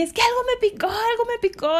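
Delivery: imitating her own whining after the sting; whiny complaint. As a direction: plead like a stung child.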